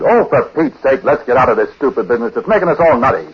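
loud exasperated cry, pitched high; angry outburst. Retold or imitated. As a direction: snap it out in fed-up anger. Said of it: "then I got mad and I said".

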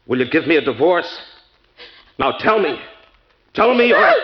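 threatening, building to a loud high bark; menacing demand. Acted. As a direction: demand an answer, building in volume. Threatening demand during marital confrontation.

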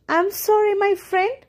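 high, lightly voiced fox; storybook fox feigning regret; coaxing fox. A narrator's reading. Fox coaxing his prey in story.

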